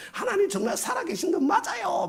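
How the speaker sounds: high shrill-pitched accusing cry at heaven; mock shrieking complaint. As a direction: shake a finger at the sky and shriek it. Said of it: "막 이러면서 막 하늘에다가 막 삿대질하고". (하늘에 삿대질하며 높게 따지는 목소리)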